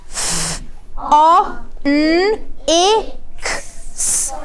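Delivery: F, O, N, I, K, S each sound said on its own; teacher models segmenting phonics. Shown as a demonstration. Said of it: "Let's say the sounds in phonics".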